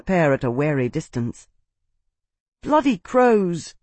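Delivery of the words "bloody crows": high, drawn-out curse; swearing. A narrator's reading. Tavi curses at the circling wind manes, high slow oath. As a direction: swear in dismay, without shouting. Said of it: "he swore".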